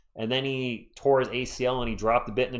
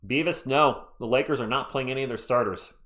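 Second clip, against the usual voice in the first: ordinary delivery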